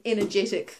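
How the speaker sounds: ordinary delivery, nothing marked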